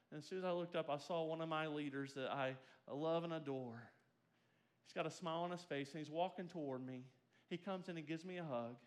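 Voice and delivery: quiet, tender storytelling; tender retelling. Acted. Preacher softly recalling his leader's gift, tender. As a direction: soften as you tell the gift.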